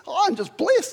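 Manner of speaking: high, bright burst of joy; joyful exclaim. Retold or imitated. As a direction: sing out the blessing. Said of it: "you can actually say".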